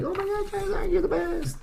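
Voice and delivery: high-pitched gushing mock voice; adoring fan. Ash still loving his mean Charizard, mock gushing. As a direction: gush like a devoted trainer, far above your own voice.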